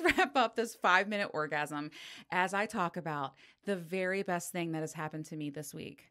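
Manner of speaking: ordinary talk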